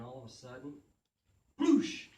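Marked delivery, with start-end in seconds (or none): 1.6-2.1 loud high whoosh sound effect. Retold or imitated. Storyteller acting the soaked cat's escape, loud whoosh. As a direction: burst out with a big whoosh. Cat bursts out of bath.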